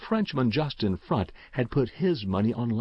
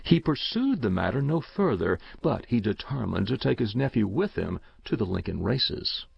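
ordinary delivery

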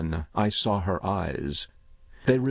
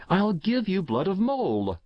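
shouted; 10 semitones higher than usual. A narrator's reading. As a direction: shout it as a sudden decision. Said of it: "I shouted".

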